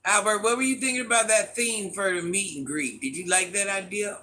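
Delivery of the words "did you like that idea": ordinary talk, nothing marked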